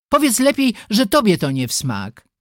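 starts high, needling a sister; bickering jab. A narrator's reading. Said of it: "zaczęły się coraz ostrzej przegadywać". (zaczepny, kłótliwy ton)

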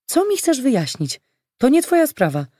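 high-pitched, annoyed challenge; indignant retort. A narrator's reading. Olga confronts the man who grabbed her elbow, indignant retort. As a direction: push back at someone meddling. Quarrel in the street.

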